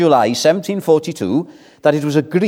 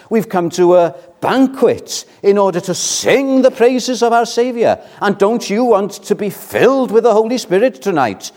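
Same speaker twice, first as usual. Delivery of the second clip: raised, higher-pitched preacher's exhortation; fervent preaching; 6 semitones higher than usual. Real, not acted. Preacher urging the church to be filled with the Spirit, fervent. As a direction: build into a rousing appeal to the congregation.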